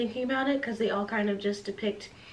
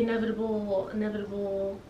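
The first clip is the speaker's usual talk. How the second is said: trailing off; 3 semitones lower, 7 dB quieter than usual. Real, not acted. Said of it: "fading repeat".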